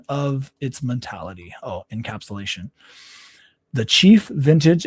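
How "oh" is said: ordinary talk, nothing marked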